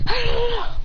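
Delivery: high-pitched mock battle yell; charging cry. Fighter rushes over at his sister, high battle cry. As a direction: yell high as you rush in.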